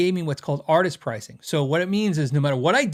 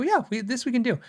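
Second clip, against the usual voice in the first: higher, bright eager salesman voice; chirpy can-do rep; cheerful factory rep catchphrase; Warwick staff impression; 7 semitones higher than usual. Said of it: "I love doing this impersonation".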